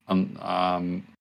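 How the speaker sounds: ordinary talk, nothing marked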